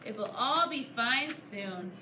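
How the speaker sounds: plain reading voice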